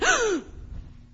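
sharp, high acted gasp; sudden storyteller's gasp of fright; startled gasp. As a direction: gasp as if it just moved. Hand near moving snake.

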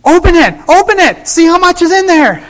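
very high, loud eager yell; excited shout. Retold or imitated. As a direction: shout it, you can't wait. Urging Susie on the phone.

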